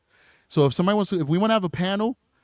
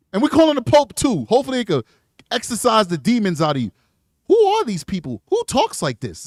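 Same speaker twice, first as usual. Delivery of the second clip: mock outrage; 6 semitones higher, 5 dB louder than usual. Real, not acted. Escalating rant.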